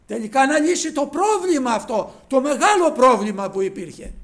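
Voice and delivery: loud, high triumphant proclamation; preaching at full force. Acted. Preacher revealing to the brethren who opens the book, triumphant loud preaching. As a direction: announce the answer in triumph.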